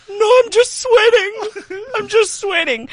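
high, slow, teary voice insisting he's only sweating; fake denial through tears. Telling the boxer who punched him he's just sweating, teary and high. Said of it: "And I was like".

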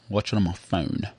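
ordinary talk

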